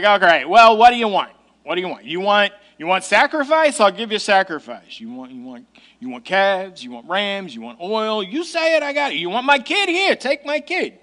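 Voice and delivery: fed-up sarcasm piling up offer after offer; sarcastic. Shown as a demonstration. Preacher voicing the people fed up with God, sarcastic. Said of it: "I used to read this text very sarcastically".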